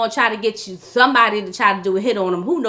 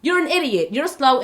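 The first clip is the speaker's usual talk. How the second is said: normal talking voice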